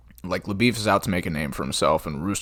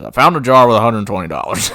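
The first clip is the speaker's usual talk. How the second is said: loud gruff witness reply; Rooster's answer; 4 semitones higher, 12 dB louder than usual. Retold or imitated. Rooster answering the judge, loud and blunt. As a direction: answer like a gunslinger on the stand. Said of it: "And Rooster says".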